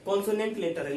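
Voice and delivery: normal talking voice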